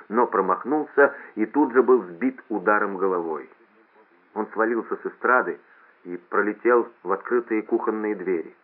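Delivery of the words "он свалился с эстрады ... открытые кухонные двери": ordinary delivery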